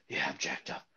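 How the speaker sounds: breathy mock bro voice; mocking bar fan. Host mocking UFC bar fans, breathy bro voice. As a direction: imitate a clueless hyped fan. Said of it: "the whole time they're like".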